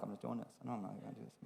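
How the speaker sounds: quiet, low murmured refusal; hushed inner refusal. Retold or imitated. Pastor refusing God's prompting in his head, hushed. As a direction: mutter it to yourself under your breath. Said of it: "This is my inner monologue".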